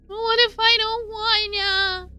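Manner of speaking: high, slow, breaking into a sob; tearful refusal. Acted. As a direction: beg not to do it.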